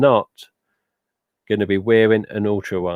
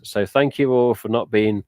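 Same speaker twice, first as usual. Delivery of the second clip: usual speaking voice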